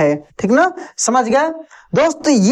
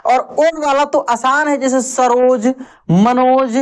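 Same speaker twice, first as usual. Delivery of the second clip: ordinary talk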